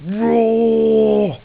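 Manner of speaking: a big, loud, high mock roar like a cartoon whale; playful roar imitating a movie whale.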